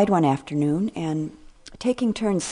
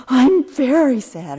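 put-on high, loud, slow wail of a sad toddler; child wailing sad; 9 semitones higher, 10 dB louder than usual. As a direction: stretch it out like a crying little boy, much higher and louder. Grandson still crying, repeats.

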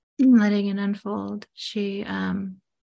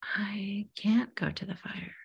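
whispered, softer fearful refusal; 7 dB quieter than usual. Retold or imitated. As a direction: breathe it out unable to go. Client's hushed reply voiced.

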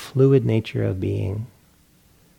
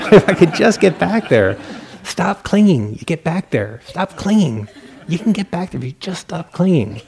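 high, loud laughing self-nagging; mock frustrated pleading, laughing at yourself; frantic self-scolding; 7 semitones higher, 5 dB louder than usual. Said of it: "Next day".

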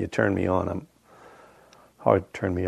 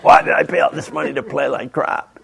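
higher mock exasperated wail; imagined frustrated golfer; 5 semitones higher, 4 dB louder than usual. Duffer who hit into the ocean, mock exasperation. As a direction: play up a golfer's comic regret. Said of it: "You'd be like".

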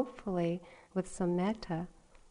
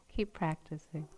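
a hint of a dry teacher, lower and softer; calm teacher; 3 semitones lower, 5 dB quieter than usual. Teacher answering the student, lightly voiced dry advice. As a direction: say it like an unbothered teacher. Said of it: "And he said".

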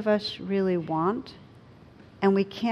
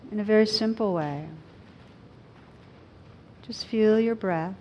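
guided meditation. Acted. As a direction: gently guide them inward.

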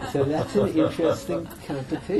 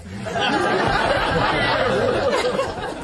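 big laugh; 11 semitones higher, 8 dB louder than usual. Real, not acted. Laughing at own story.

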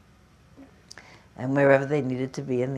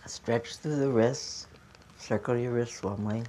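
calm, unhurried cues for a stretch class; yoga instruction; 4 dB quieter than usual. Acted. Yoga teacher guiding her students through arm stretches, calm instruction voice.

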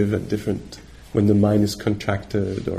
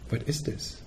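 "What is this?" breathed out unvoiced, much softer and higher; whispered question; 4 semitones higher, 11 dB quieter than usual. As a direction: whisper the question "What is this?" as a curious inner inquiry. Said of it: "she would say".

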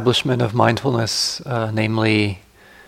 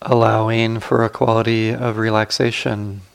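guided meditation. Acted. Teacher guiding sitters to relax the body, calm guided meditation.

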